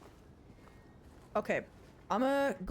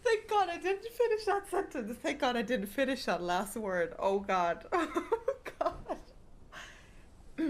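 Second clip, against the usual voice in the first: high, helpless laughter through the words; squealed relief through laughter; laughing; 6 semitones higher than usual. Real, not acted. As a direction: barely get it out while cracking up. Cracks up at own slip.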